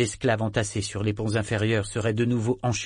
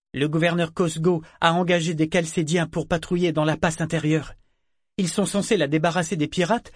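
higher-pitched character voice, agitated; puzzled female ship figure; 6 semitones higher than usual. A narrator's reading. Vivacia wonders why war galleys chase them, puzzled. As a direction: reason aloud in a raised pitch.

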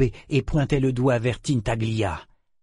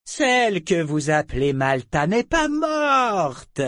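high, slow, imperious dragon voice; abrupt dragon interruption; 7 semitones higher, slower than usual. A narrator's reading. Dragon breaks into the humans' grief, abruptly. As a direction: cut in grandly, stretching the words out. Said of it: "intervint brusquement le dragon".

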